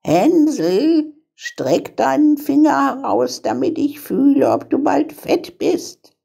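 high-pitched witch calling to her captive; high witch voice, giving orders; old witch calling. A narrator's reading. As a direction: call out as a wheedling old witch. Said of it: "schlich die Alte zu dem Ställchen und rief".

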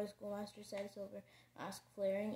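usual speaking voice

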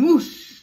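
loud, rushing whoosh. A narrator's reading. Teacher voices the broomstick taking off, loud whoosh. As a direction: let the word burst out like take-off.

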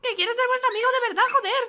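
hammed-up desperate whine; exasperated plea. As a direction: beg in a rush, fed up. Pleading bird voice, frustrated.

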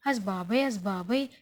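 mock mother's oh dear, oh dear; alarmed exclamation. Mother seeing son-in-law storm off, lightly voiced alarm.

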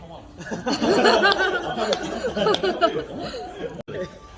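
long, loud high laugh; burst of laughter. Real, not acted. Friends teasing over drinks, long loud laughter. Group banter sets off laughter.

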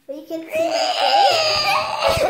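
giggling high and loud; laughing. Real, not acted. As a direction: laugh through the words. Laughing while hiding face.

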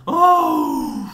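long high "oh" cry; loud startled howl. Real, not acted. Player reacts to a near disaster, startled howl. Shock during play.

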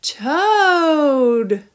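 loud, drawn-out toad croak. A narrator's reading. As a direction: boom the word out like a croaking amphibian.

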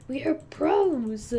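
proud team boast; cheering the team on, slowly. Real, not acted. Bragging while winning.

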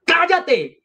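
high, desperate cry to silence her; frantic shout. A narrator's reading. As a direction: yell at her to hush, choking on it. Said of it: "gritaba presurosa, sofocándose y tosiendo".

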